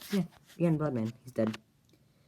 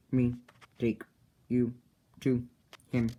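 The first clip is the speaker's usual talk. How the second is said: one word at a time; halting frog speech. Retold or imitated. Frog answers Bloodman about his leader, halting word by word. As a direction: separate each word with a gap, like a creature new to speech.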